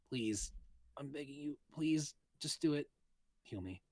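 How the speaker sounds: put-on soft, breathless pleading girl; weak begging. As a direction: beg softly, barely holding on. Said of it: "called out to me in a weak voice".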